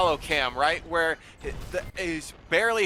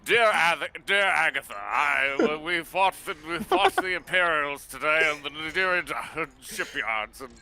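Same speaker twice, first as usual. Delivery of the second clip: solemn letter home; war letter voice. Pretend. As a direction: read it like a soldier's diary. Pilot dictating a letter home.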